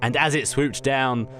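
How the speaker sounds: ordinary talk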